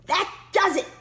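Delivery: put-on high, sharp exclamation of an old knight; shouted. A narrator's reading. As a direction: burst out, fed up with the dragons. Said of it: "Don Pedro shouted".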